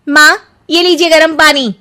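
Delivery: high, loud sugary offer; overly sweet daughter-in-law voice; sweetly offering. Acted. Daughter-in-law hands mother-in-law scalding water, sugary sweet.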